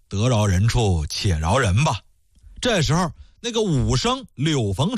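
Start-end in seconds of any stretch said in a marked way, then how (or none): 0.1-2.0 low, slow soothing advice; coaxing magistrate. Acted. Magistrate urges the widow to forgive, low and coaxing. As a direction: talk her down gently, lower. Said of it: "这岳知县呢就劝".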